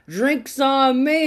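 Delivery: high, stretched-out call; barroom announcement. Acted. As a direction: draw it out high like a toast to the room. Joke's man repeats his announcement.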